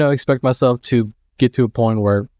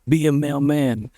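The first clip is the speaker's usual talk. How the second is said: mild mock yell, a little higher and slow; imitating mom yelling; 4 semitones higher, slower than usual. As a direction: lightly mimic a nagging mom. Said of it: "his mom yelling about".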